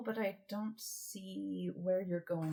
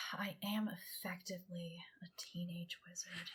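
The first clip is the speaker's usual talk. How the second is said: whispering; 9 dB quieter than usual. Acted. Whispered reluctant admission.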